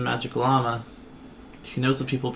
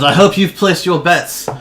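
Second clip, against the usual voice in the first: arena announcer; 2 semitones higher, 8 dB louder than usual. Acted. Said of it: "He goes".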